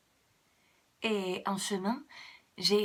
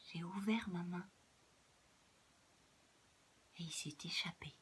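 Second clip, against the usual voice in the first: hushed narration; 6 semitones lower, 13 dB quieter, faster than usual. A narrator's reading. Hushed release moment.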